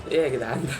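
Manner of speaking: loud startled cry; startled yell. Real, not acted. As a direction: jump at the scare. Monster appears in game.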